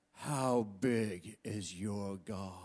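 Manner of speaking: low, slow, quiet preacher's question; preacher's challenge. Retold or imitated. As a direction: ask it slowly and quietly, like a preacher probing the crowd. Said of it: "and he'd go".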